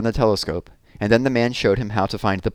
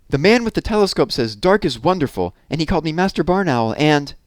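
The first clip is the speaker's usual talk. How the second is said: high, quick, breathless owlet tumbling out news; proud little owl rushing to tell his mother; excited young owl; 7 semitones higher, 3 dB louder than usual. Acted. Plop reports excitedly to his mother.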